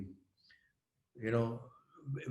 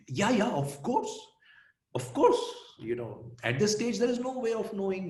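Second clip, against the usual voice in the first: sarcastic; 9 semitones higher than usual. Retold or imitated. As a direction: agree while plainly not meaning it. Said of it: "full of sarcasm".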